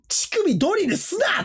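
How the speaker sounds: high, drawn-out Kansai comedian's retort; mock Kansai-dialect heckle; comic Kansai retort. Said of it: "急にそういう関西弁".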